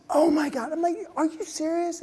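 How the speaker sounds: high, shocked gasp of joy; surprised delight. Retold or imitated. Speaker hearing the repairman's wife was surprised, stunned and high. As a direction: react like the news amazes you. Reacting to Billy's happy news.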